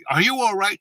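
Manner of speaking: high, loud anxious phone voice; acted-out urgent concern; worried grandma. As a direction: imitate a grandma checking on a child.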